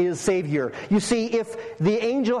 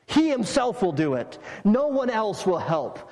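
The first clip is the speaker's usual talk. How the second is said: ordinary talk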